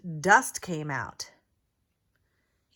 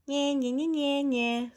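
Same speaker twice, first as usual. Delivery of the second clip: high, mocking nyeh-nyeh bird voice; taunting bird snort; 6 semitones higher than usual. A narrator's reading. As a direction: tease like a smug bird. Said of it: "snorted the flugel bird".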